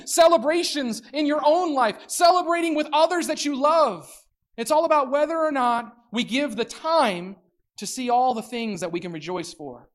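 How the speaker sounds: ordinary talk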